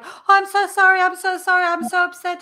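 high, loud, flustered run of apologies; mock tearful spiral of apologies; panicky apology. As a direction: gush out sorry after sorry in a rising panic. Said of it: "they go in this spiral, like".

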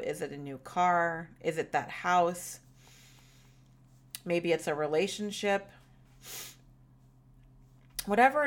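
gentle, calm visualization guiding; guided meditation. Shown as a demonstration. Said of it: "a guided meditation and visualization".

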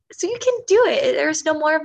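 rally the listener at full force; "so you can do it" cried out with DO punched; near shout. Real, not acted. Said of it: "building emphasis".

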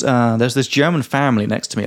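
ordinary talk, nothing marked

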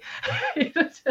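a high burst of laughter at her own joke; high amused laugh after the punchline. Real, not acted. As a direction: let a bright laugh out at your own punchline.

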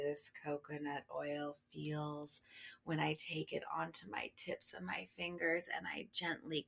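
quick whispered murmur; fast whisper. Acted. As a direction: rush it in a whisper. Whispered sensual detail.

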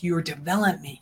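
usual speaking voice

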